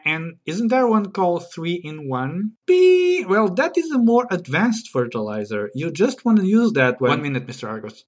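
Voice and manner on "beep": high, drawn-out "pee"; signal beep. Shown as a demonstration. Host demonstrates his sound before talking, high beep. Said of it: "So my sound is pee".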